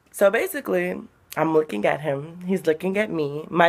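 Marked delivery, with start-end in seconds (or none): none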